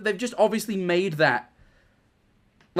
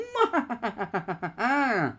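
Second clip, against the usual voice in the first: high giggly sinister cackle; evil clown laugh; 6 semitones higher, 5 dB quieter than usual. Pretend. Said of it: "that's my killer clown laugh".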